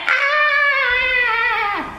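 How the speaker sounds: long, high-pitched held yell; drawn-out comic "aah" at high pitch; mock scream. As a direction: imitate a stuck kid yelling out. Acting out kid being pulled free.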